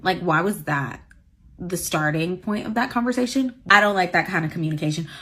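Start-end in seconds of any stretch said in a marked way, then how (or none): none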